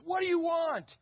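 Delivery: loud, high angry yell; old man screaming at the door. Retold or imitated. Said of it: "he screams".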